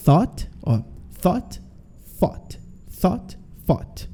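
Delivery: "thought" said over and over, slow and soft, tongue between the teeth; repeat-after-me drill. Shown as a demonstration. Teacher drilling students to repeat "thought" together, softly and carefully. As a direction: model "thought" for the class to copy, quietly and carefully. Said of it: "vai tentando pronunciar aí junto comigo".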